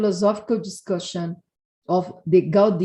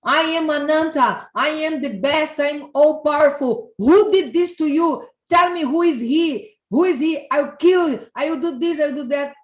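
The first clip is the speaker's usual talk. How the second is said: much higher and louder, swaggering; boasting big brother; 7 semitones higher, 4 dB louder than usual. Acted. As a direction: puff up like a mighty protector. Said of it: "and said".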